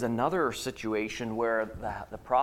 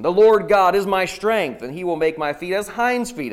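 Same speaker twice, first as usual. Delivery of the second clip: triumphant; 6 semitones higher, 9 dB louder than usual. Acted. Climax of Habakkuk's praise.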